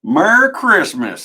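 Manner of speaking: high, loud, slow Southern drawl; Southern accent. Shown as a demonstration. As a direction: belt it out in a hammy Southern accent. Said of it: "Southern accent".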